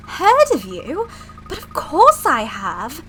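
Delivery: high, emphatic assistant with a put-on British accent; gushing British assistant. Assistant admits knowing all about the professor, gushing British voice.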